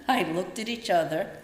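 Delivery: normal talking voice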